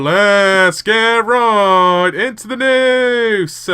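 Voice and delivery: slow, drawn-out drama news host; Keemstar news intro. Pretend. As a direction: hype up the news opening slowly. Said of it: "I'm your host, Killer Keemstar".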